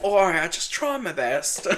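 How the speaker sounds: British attempt sliding into Australian; slow, badly faked British-Australian accent. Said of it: "what do you call Australian?".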